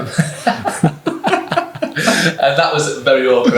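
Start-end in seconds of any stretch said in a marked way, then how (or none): 0.1-3.6 slow, high, laughing words; talking through the giggles. Real, not acted. Laughing over an awkward story.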